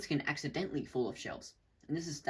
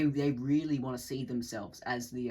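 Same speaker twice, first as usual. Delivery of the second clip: ordinary talk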